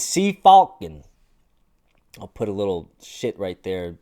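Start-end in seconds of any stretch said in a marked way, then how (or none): 0.0-1.0 loud, slow, high German pronunciation; hammed-up German word demo; German impression. Shown as a demonstration. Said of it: "this is my best German impression".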